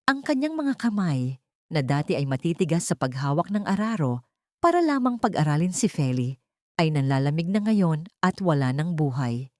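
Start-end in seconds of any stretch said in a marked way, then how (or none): none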